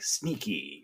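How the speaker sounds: imitated sly line delivery. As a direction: drop it low and soft, imitating a sneaky character. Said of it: "Calhoun del-, delivers that line".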